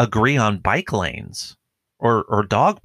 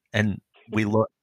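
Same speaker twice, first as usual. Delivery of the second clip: ordinary delivery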